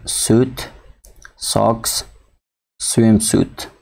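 teacher modelling the hissed "s" in سوت، ساکس، سویم سوت, word by word; سوت، ساکس، سویم سوت as separate words, each with an "s" as in "see"; S sound demo. Shown as a demonstration. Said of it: "S آواز سی نمیته".

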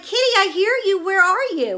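high-pitched, coaxing call to a kitty; calling a cat. Retold or imitated. Owner hunting for the hidden stray, calling out high.

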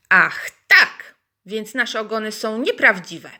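mildly indignant hens calling out. Acted. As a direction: hens taking offence. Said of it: "zawołały oburzone kury".

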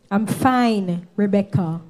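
firm irritation. Acted. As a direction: shut her concern down firmly. Quarrel with choir mate.

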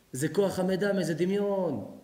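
normal talking voice

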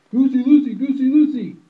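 very high, very loud hen screech; frantic yell. Acted. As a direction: scream the alarm across the yard. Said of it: "and yelled".

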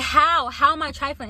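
retold heated comeback; indignant demand. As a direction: push back, heated. Arguing with the crying girl.

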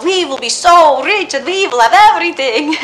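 laughing through the words, loud; giddy laugh spilling over the dream. Acted. Wife laughing as she dreams of riches. Dreaming aloud of being rich.

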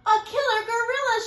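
high, loud terrified squeak; squeaky fright. A narrator's reading. Mouse telling chipmunk who chases her. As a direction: squeak out the danger. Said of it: "she squeaked".

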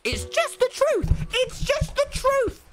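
very high drawn-out insistence; mock solemn. Real, not acted. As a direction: insist in a high, sing-song falsetto. Joking insistence.

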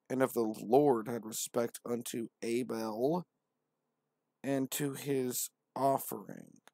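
slow solemn Bible reading voice; scripture reading. Acted. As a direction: read it like a solemn preacher.

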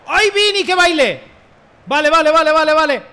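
high, loud excited yelling; thrilled live-gameplay shouting; gritos de emoción. Real, not acted. (gritos agudos de emoción)

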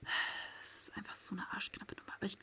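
very soft whispered repeat. Real, not acted. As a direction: hush it to yourself in suspense. Hiding, low ammo.

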